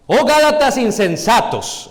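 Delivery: loud, high, drawn-out exclamation; exclamación. Shown as a demonstration. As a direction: cry it out as a forceful rebuke. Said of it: "Está exclamando". (exclamando con fuerza)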